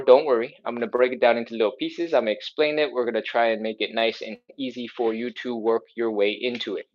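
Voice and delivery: normal talking voice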